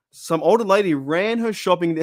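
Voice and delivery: reading aloud until laughter takes over; cracking up. Real, not acted. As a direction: start the story, then dissolve into laughing. Cracks up reading a chat message.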